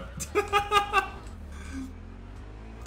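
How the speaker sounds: high, voiceless giggling; laughter. Real, not acted. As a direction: giggle helplessly after the scare. Laughing after near crash.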